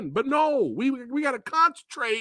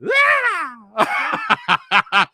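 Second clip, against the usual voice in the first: a mock scream, a bit louder; imitated scream; 2 semitones higher, 5 dB louder than usual. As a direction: repeat the scream like that politician. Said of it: "Like that politician".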